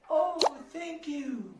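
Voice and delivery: high, slow, delighted "Oh, thank you."; wife's thanks. Acted. Teacher voices his wife getting flowers, high and delighted. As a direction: play the wife, pleased with the flowers.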